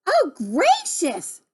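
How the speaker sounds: high, slow, drawn-out dismay; exclaiming. Acted. Jubal hearing the cottage floated away, exclaiming. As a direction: stretch it out in high astonishment.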